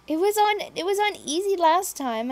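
normal talking voice

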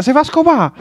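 sudden shouted exclamation; loud outburst. Acted. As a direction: blurt it out loudly. Shocked outburst at brazilians.

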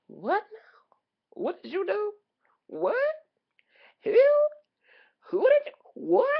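high, rising incredulous questions; mock bafflement. Comic reacting to nonsense lyrics, high-pitched and baffled. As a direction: squeak out each baffled question, voice shooting up.

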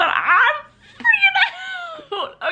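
emotional, breaking into a high squeal; overwhelmed, half laughing, half crying; freaking out. Real, not acted. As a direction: let the voice leap up into a stretched squeal.

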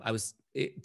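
ordinary talk

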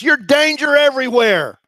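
high, loud yelled accusation; yelling. Retold or imitated. Preacher voicing Jeremiah yelling at Pashur. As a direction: yell it at him, pointing. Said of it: "and just yells at him".

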